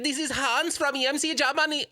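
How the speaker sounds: high-pitched mock German phone greeting; hammed-up squeaky German caller; terrible German accent. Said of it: "I do a terrible German accent".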